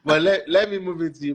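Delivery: chuckling through the words; laughing. Real, not acted. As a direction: laugh as you hand over. Host laughs while handing over.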